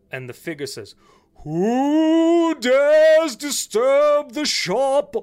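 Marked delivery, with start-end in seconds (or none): 1.4-5.2 booming slow ghost voice, high and loud; unearthly voice. Acted. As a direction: drag out each word like a haunting spirit.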